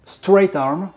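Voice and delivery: loud, high English-accented "Straight Arm". Shown as a demonstration. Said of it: "ce que vaut mon accent anglais". (accent anglais appuyé, plus aigu et plus fort)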